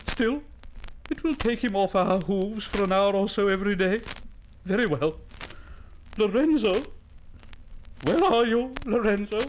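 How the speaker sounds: high, put-upon animal mother voice; long-suffering llama mother. Acted. Llama mother grudgingly lets her child go out, long-suffering. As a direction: sigh over the imposition, then call the child.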